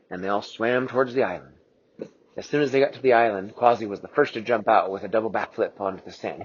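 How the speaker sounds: usual speaking voice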